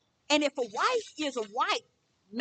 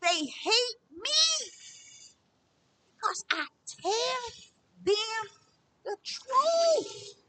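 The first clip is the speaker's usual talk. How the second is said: high, slow, breaking into a cry; crying out the words high and slow; tearful; 9 semitones higher, much slower than usual. Retold or imitated. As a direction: let the voice crack with tears. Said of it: "I was like".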